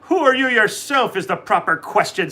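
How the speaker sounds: proud little leprechaun answering back. A narrator's reading. Leprechaun Patrick answers Donald back, lightly voiced and proud. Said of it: "said Patrick Begorra".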